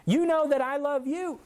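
high, exasperated retold protest; frustrated. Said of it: "Peter was frustrated".

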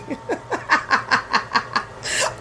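high cackling laughter; long laughing fit. Real, not acted. Cracks up after UK shout-out.

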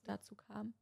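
hoarse voice cracking away to breath; voice giving out. Real, not acted. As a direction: voice failing to a whisper. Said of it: "meine Stimme versagt gleich".

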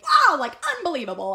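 loud, high incredulous wow; outraged disbelief. Retold or imitated. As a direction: exclaim it, stunned and indignant. Outraged at the host's excuse.

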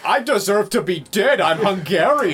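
high, loud, badly faked Hungarian; mock Hungarian accent. Mocked as speaking backwards, wild Hungarian accent. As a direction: belt out a garbled mock Hungarian, slowing at the end. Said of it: "why are you speaking backwards?".

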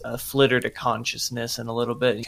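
normal talking voice

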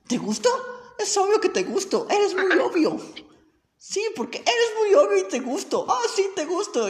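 very high, sing-song teasing; mock a girl who insists he likes her. Storyteller mimicking the girl teasing him, very high and taunting.

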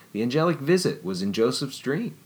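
plain reading voice

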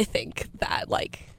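breathy whisper aside. Real, not acted. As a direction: drop to a whisper. Drops into whisper.

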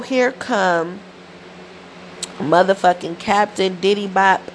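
ordinary talk

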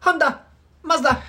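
high mock Japanese gibberish; fake Japanese. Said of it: "pretending to speak Japanese".

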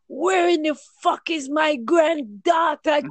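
high, loud angry shout; angry yell. Retold or imitated. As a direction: shout it out, hunting for a lost kid. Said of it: "he got really angry".

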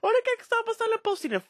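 high, whiny mock voice of the preachy bore; whiny know-it-all. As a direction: squeak it out like an annoying activist. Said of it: "e vou meter".

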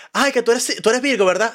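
high, gushing mock of an astrology fan. Mocking to a friend the people who guess your star sign, high and gushing. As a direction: camp it up like someone sure of your sign.

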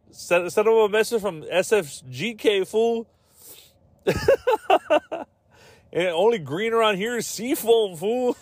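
mock gangster boast. Seafoam gang member boasting to rival, high mock thug voice. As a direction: brag like a cartoon gangster.

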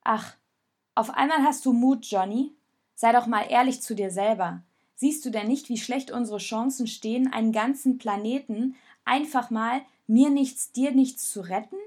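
loud, challenging counter-attack; angry retort. Acted. Raoul challenges Jonas, loud and defiant. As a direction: hit back at the mockery.

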